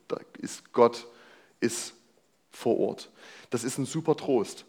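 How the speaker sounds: ordinary talk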